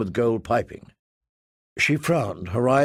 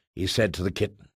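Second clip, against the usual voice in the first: usual speaking voice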